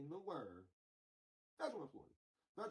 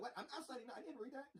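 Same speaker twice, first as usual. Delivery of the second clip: high, laughing mock voice; squeaky mock senator, half laughing; 10 semitones higher than usual. Mock senators refusing to sign.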